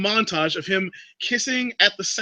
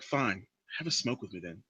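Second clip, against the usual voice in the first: very low, hushed creep voice; creepy come-on; 11 semitones lower, 12 dB quieter than usual. Acted. Creepy guy offering her a smoke, creepy. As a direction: murmur the invitation like a creep. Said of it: "he does that creepy voice that I just did".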